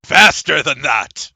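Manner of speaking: loud, slow, stern command; impatient order. Acted. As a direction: push him to hurry up. Inquisitor hurrying player.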